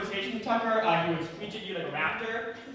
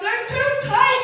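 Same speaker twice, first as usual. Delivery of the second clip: put-on child yelling back; 8 dB louder, slower than usual. Kid shouting back.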